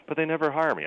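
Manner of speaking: high, loud imitation of an Italian accent. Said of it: "he has a really thick Italian accent".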